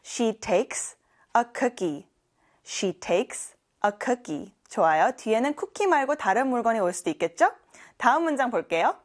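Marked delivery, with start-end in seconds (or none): 0.1-4.5 very slow, carefully spaced English; slow classroom demo; slow model sentence. Shown as a demonstration. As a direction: say it slowly for learners to repeat. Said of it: "같이 해볼까요?".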